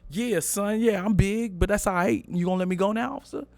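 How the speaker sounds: high, loose and cocky to the cop; cocky swagger. Retold or imitated. Cocky driver teasing the state trooper, high swagger. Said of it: "Did you say it like that".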